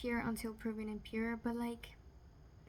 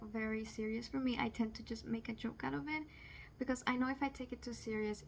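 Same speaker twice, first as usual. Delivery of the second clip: normal talking voice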